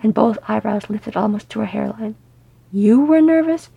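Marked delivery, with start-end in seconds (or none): 2.7-3.7 high, loud disbelief; indignant, raised-pitch challenge; incredulous retort. A narrator's reading. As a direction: throw it back in outraged disbelief. Incredulous retort to his excuse.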